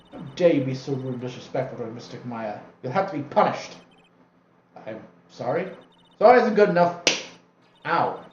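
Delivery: scolding attendant. Acted. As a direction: scold him for the disrespect.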